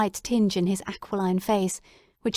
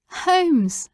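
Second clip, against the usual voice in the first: high, louder cry of his name; 8 semitones higher, 4 dB louder than usual. Acted. Watson crying out to Holmes, back from the dead, high and loud. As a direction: call out in disbelief. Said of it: "I cried".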